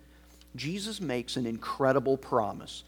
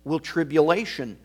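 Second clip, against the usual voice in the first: louder, rising scripture reading; ringing pulpit reading; preaching scripture; 6 dB louder than usual. Acted. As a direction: proclaim it to the congregation. Reads Romans 8 with force.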